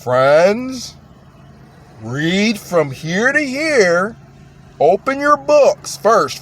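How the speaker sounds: very slow, stretched-out instructions with swooping pitch; exaggerated, over-careful preacher addressing friends; Stephen Lett. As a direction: drag out each word for slow listeners. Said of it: "That's Stephen Lett".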